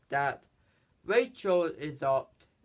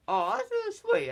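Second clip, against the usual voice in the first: squeaky high voice. Retold or imitated. Child voicing a character.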